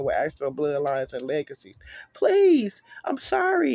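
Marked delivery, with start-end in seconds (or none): 2.1-3.7 high, loud desperate begging through sobs; hysterical sobbing plea. Acted. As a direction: beg for your life, sobbing. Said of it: "he sobs hysterically".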